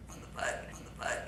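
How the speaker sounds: breathless, voiceless whisper of panic; hushed terrified whisper; frightened whisper. Retold or imitated. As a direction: whisper it like you're scared to be heard. Terrified, frozen in sleep paralysis.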